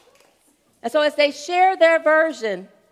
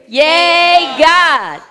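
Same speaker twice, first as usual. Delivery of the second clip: loud group cheer together; many voices in unison; 5 semitones higher, 12 dB louder than usual. Real, not acted. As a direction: everyone shout it at once. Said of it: "Can you all say it together?".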